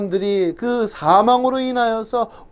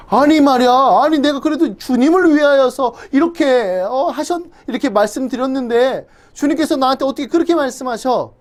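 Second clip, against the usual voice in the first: higher, protesting, wounded disbelief; indignant Peter; 6 semitones higher than usual. Retold or imitated. Peter protesting to Jesus after rebuke, flustered. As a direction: complain as a hurt, confused disciple.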